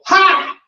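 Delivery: one high dry "Ha!"; mock flat heckler laugh; sarcastic single laugh. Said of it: "they would just go".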